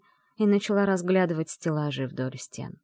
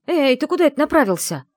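high, loud indignant challenge; indignant outcry; 10 semitones higher, 9 dB louder than usual. Acted. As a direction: call him out, alarmed. She protests being carried. (возмущённый громкий окрик)

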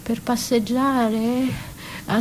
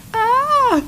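sharp cry; 13 semitones higher, 4 dB louder than usual. Acted. Sudden pain from burning hair.

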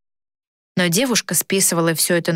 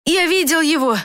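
put-on high-pitched excited boy; breathless cry; 10 semitones higher than usual. A narrator's reading. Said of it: "запыхавшись, закричал мальчишка". (взволнованный высокий мальчишеский выкрик)

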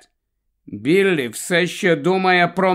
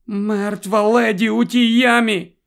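crying; 8 semitones higher, 3 dB louder than usual. A narrator's reading. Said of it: "не зміг стримати сліз".

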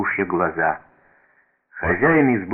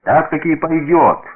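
teasing mock disbelief; feigned surprise; 9 semitones higher, 6 dB louder than usual. A narrator's reading. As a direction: raise the pitch as if amazed. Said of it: "притворно удивился хозяин избы".

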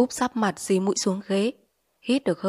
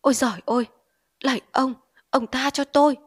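higher, louder frightened pleading; terrified cry; 5 semitones higher, 4 dB louder than usual. Acted. Addict in delirium begging a phantom, terrified cry. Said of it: "giật nảy mình rú lên".

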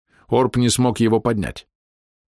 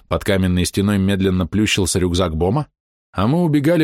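ordinary delivery, nothing marked

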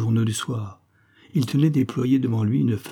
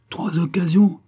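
higher and louder, hurling the words back; scornful shout; 7 semitones higher, 5 dB louder than usual. Acted. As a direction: shout trois occasions back in disbelief. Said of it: "hurla ma compagne". (crié avec mépris, plus fort et plus aigu)

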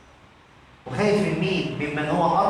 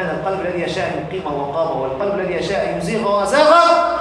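ordinary delivery

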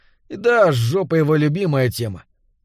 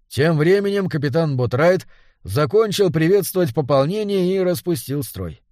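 plain reading voice

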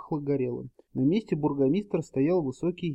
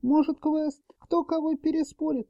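tiny elf whisper; 13 semitones higher than usual. A narrator's reading. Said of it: "прошептала крошечная эльфийка".

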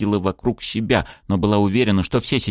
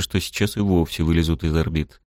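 ordinary delivery, nothing marked

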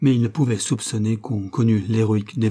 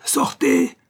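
even higher, strained hoarse yell; harsher hoarse shout; 4 dB louder than usual. A narrator's reading. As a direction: scream him out the door. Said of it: "cria-t-il d'une voix rauque".

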